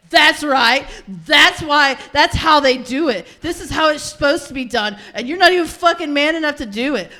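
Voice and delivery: loud, high, punched-out gloating; domineering taunt. Acted. Housewife humiliating her tied-up husband, loud scornful taunting. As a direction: rub it in, belittling and forceful.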